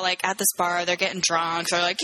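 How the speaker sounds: normal talking voice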